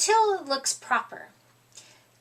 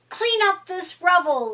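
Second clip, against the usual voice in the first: ordinary delivery, nothing marked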